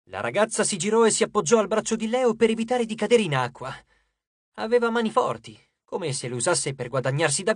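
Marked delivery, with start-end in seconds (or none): none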